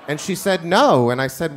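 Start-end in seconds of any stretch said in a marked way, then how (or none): 0.6-1.1 short loud flat No; kid's curt flat refusal; blunt no. Retold or imitated. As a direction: snap back a flat loud No.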